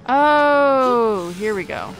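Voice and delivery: ordinary talk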